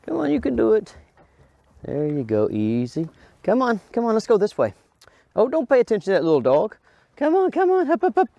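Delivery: high, bright coaxing to a dog. Real, not acted. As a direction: cheer the dog on, pitch way up.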